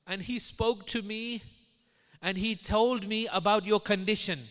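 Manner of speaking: loud, high, slow and commanding; proclaiming Imam. Retold or imitated. Imam addresses the sick wife in a vision, proclaiming. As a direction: proclaim it in measured, ringing phrases.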